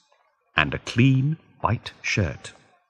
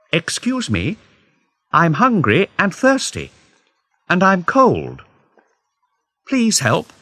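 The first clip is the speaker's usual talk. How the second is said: higher, pleading voice begging a passerby; pleading beggar; 9 semitones higher, 3 dB louder than usual. A narrator's reading. Narrator begging a woman in the street, pleading. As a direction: plead with her for help.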